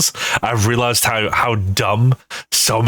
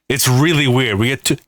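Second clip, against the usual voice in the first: ordinary talk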